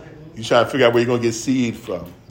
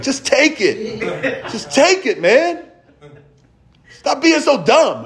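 higher, louder bossy sister voice with a laugh; scolding big sister; 11 semitones higher, 4 dB louder, slower than usual. Retold or imitated. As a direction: play the exasperated big sister shoving it at him. Big sister scolding little brother.

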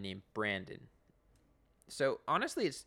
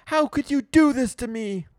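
much louder, higher wail; hammed-up wounded yell; mock yell; 13 semitones higher, 16 dB louder than usual. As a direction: shout it out accusingly. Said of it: "Eugene yells".

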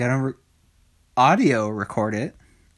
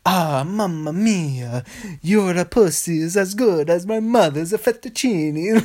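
drawn-out, higher cartoon Italian accent; mock Italian lover; 5 semitones higher than usual. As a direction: croon it like a hammed-up Italian, close to laughing. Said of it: "Just the guy going".

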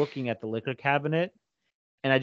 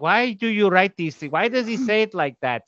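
higher, mock complaining mother; imitating mom; 7 semitones higher than usual. Mother complaining about the son's radio comments, imitated higher. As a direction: whine like mom. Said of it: "my mom's like".

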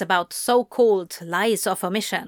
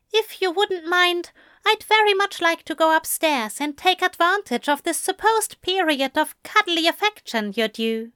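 higher-pitched, well-mannered angel voice; Aziraphale, politely inviting; 9 semitones higher than usual. A narrator's reading. Angel inviting Crowley upstairs for cuddles, politely. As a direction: propose it politely in a high voice.

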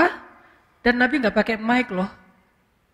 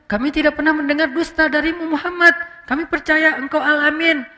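higher-pitched acted declaration; crowd's eager avowal; 5 semitones higher than usual. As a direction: answer emphatically as a whole crowd, voice lifted. Acts out the crowd's loud avowal.

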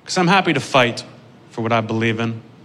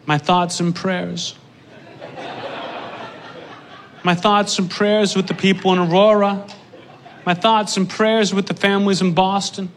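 mocking pious posters; 5 semitones higher than usual. Mocking people posting thoughts and prayers, sing-song. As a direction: parody the empty condolence.

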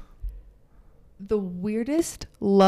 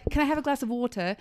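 quick, softer line in English accent; 2 semitones higher, 5 dB quieter, faster than usual. Shown as a demonstration. Asking for water to avoid teasing, adopted English accent. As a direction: ask politely in an English accent. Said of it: "the English accent to say".

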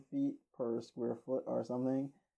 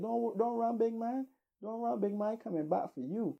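much higher, mocking threat; taunting street man; 10 semitones higher than usual. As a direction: jeer after him as he walks off. Raving man shouts a threat.

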